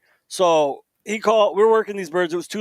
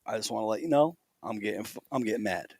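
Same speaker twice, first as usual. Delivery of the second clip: retold low, hushed warning; quiet warning; 7 semitones lower, 10 dB quieter than usual. Telling his buddy he's getting mad, quiet warning. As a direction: drop it low and soft, confiding to a buddy.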